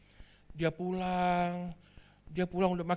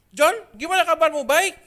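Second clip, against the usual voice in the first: loud eager greeting; 7 semitones higher, 7 dB louder than usual. Acted. Friend greets Jono at campus gate.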